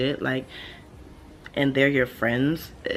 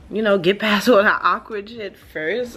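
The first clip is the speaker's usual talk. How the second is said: louder, laughing through the words; 6 semitones higher, 7 dB louder than usual. Real, not acted. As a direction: laugh it out exasperated. Loud laughing emphasis.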